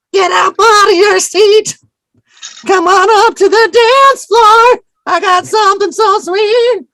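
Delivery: put-on high, slow, quavering old lady singing; grandma voice. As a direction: croon it like a shaky old grandma. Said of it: "I called it the grandma voice".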